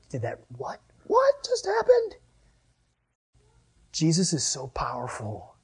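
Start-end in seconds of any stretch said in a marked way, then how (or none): 0.1-2.1 dazed whisper jumping to a high squeak; gobsmacked, can't find words; stunned, then a high disbelieving squeak; stunned disciple. Retold or imitated. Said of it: "I'd just be like".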